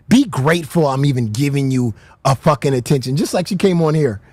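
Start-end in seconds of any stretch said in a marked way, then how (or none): 0.1-3.2 mocking haughty tone. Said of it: "she thinks she's just above".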